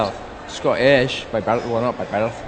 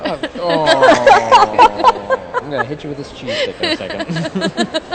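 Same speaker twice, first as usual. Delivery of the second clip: mock outrage; 14 semitones higher, 6 dB louder, much slower than usual.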